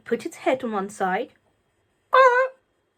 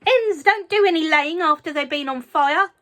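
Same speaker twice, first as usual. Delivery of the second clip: shouting; 8 semitones higher, 8 dB louder than usual. A narrator's reading. Grandma continues.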